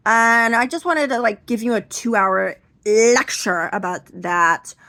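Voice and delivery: high, loud preachy grandma; hammed-up lecturing grandma; grandmother voice. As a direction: scold like a nagging grandmother. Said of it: "she would go like this".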